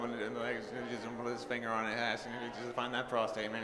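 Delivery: low, fast Texan mumble; King of the Hill mumbler. Acted. Comic plays King of the Hill character, fast low mumble. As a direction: rattle it off in a low, monotone Texan mutter. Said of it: "Oh, Jim Howard".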